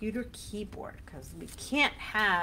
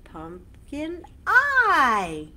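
loud slow call; 6 semitones higher, 9 dB louder, much slower than usual. Shown as a demonstration. Chant with punched endings.

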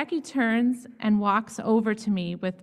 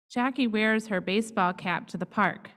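ordinary delivery, nothing marked

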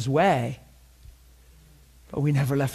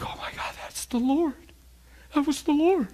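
high, amazed retold gasp of wonder; awed exclamation; 12 semitones higher than usual. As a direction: sound struck with awe, voice jumping up. Retold awed reaction of guests.

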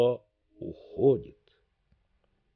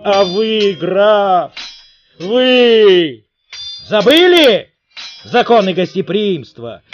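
loud, higher, outraged challenge; furious shout; 7 semitones higher, 8 dB louder than usual. A narrator's reading. Betrayed king confronting his wife and the count, furious shout. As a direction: hurl the accusations at the lovers. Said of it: "раздаётся гневный возглас".

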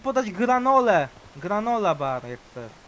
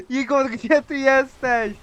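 ordinary talk, nothing marked

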